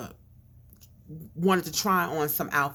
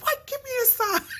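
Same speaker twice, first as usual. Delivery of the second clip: shocked shopper. Retold or imitated. Shoppers reacting to protest story.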